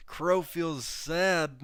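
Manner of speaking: slow, high pretend-sad voice; speaking for Crow, slow mock sadness. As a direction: drag it out like a sulking child. Voicing a chatter's feelings.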